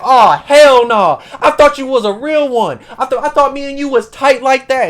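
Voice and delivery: loud, high, outraged outburst; acted furious indignation, voice way up; pissed off. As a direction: cry out betrayed, drawing out the start. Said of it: "And she's pissed off".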